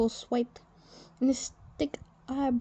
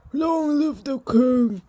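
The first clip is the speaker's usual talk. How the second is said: slow, loud ceremonial cry, higher; proclaiming shout; 4 semitones higher, 8 dB louder than usual. Acted. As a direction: call it out to the whole room. Courtiers proclaim new king.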